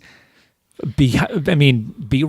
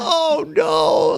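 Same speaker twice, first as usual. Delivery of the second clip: mock dismay. Retold dismay at the opening scene.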